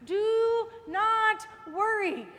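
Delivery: very slow, stretched do not worry; slow emphatic. Acted. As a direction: drag out every word for weight. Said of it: "preaching emphasis".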